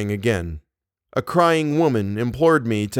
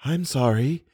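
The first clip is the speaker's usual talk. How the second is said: breathy voice; 3 semitones higher than usual. A narrator's reading. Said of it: "he said in his breathy voice".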